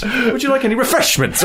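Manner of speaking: refreshments punched at the end, very high; stress on REFRESHMENTS. Retold or imitated. Imitating train trolley man, stress on refreshments. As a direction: save all the weight for refreshments. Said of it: "moving the emphasis down the sentence".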